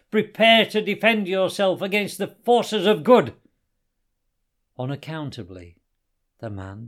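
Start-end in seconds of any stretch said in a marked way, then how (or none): 0.1-3.3 high and very loud challenge; heroic battle cry. A narrator's reading. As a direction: proclaim it like a champion of good.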